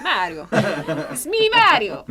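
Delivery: hammed-up video game plumber; Mario voice. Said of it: "the way that he says it".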